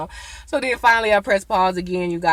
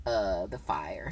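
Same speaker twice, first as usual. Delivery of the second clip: soft low Nate voice; 6 semitones lower, 8 dB quieter than usual. Retold or imitated. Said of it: "talking for Nate".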